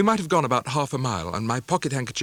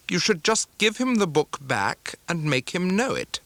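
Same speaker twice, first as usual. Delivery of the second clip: higher, cold and curt; harsh spinster; 7 semitones higher than usual. Acted. Sister-in-law ordering mother about the lesson, cold and curt. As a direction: snap out the order.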